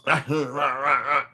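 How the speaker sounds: unintelligible mock mumbling; garbled talk imitation. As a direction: imitate an old man's garbled jabber with no real words. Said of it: "you know how he talks".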